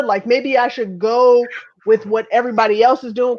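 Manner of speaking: ordinary delivery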